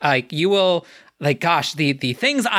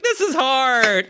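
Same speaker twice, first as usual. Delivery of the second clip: whining; 8 semitones higher, much slower than usual. Retold or imitated. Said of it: "where we're just whining".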